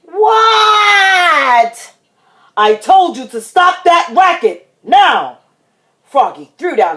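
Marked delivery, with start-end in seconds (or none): 0.0-1.9 high, loud, wailed reply; pestered kid's long yell; yelling back. Acted. Child yells back at dad. 2.6-5.4 loud, raised telling-off; stern parental reprimand; dad scolding. Acted. Dad scolds noisy child.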